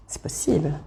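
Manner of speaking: "C'est possible" breathed out as a soft unvoiced whisper. Real, not acted. Tarot reader to viewers, teasing secretive whisper.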